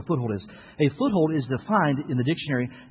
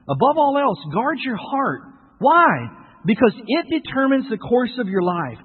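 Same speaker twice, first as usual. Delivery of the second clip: raised, emphatic preacher voice; forceful preaching; 9 semitones higher, 8 dB louder than usual. Real, not acted. Preacher warning against burying what's alive, forceful and raised. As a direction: drive the warning home, higher and insistent.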